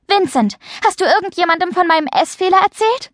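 high, rushed accusing question; urgent, high-pitched confrontation; agitated questioning. A narrator's reading. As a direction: demand an answer, fast and upset. Upset Mia confronts her friend.

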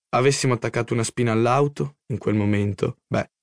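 ordinary delivery

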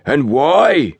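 high, outraged pompous demand; awful tones. A narrator's reading. Station master rounds on his porters, in awful tones. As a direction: demand it with wounded dignity. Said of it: "he demanded in awful tones".

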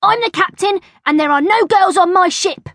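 high, loud, self-important schoolboy; childish pulling of rank; bossy captain. A narrator's reading. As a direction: lay down the law to a playmate. Bertie pulls rank as captain.

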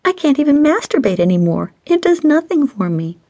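high-pitched woman's complaint, mock pouting; pouty, whining friend. A narrator's reading. Stacy griping to her friend, pouty whining. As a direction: whine playfully in a high voice.

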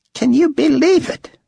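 very high-pitched Aussie excitement; amazed gushing. A narrator's reading. Driver marvelling at the risen leader, amazed. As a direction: gush in wonder.

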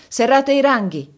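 loud high command to the troops; officer calling his men into ranks, loud shout; shouted order. A narrator's reading. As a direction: bark it out like an officer on the march. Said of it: "gridò Carjan".

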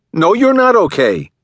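high, loud cut-in; angry interruption. A narrator's reading. Jason cuts off his wife, loud and high. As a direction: cut her off, refusing. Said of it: "Jason interrupted".

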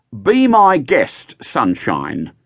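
put-on slow, slurred drunken words. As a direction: slur it like a drunk in a cell. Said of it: "spoken in a slurred voice".

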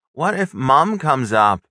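loud, high, slow alarmed question; urgent hiss. A narrator's reading. Stepbrother warns stepsister Mom might come, hissing. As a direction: press the worry urgently, drawn out. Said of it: "I hissed".

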